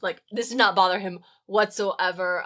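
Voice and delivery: usual speaking voice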